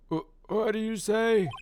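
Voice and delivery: high startled judge voice; flustered judge. Acted.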